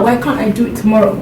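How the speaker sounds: ordinary talk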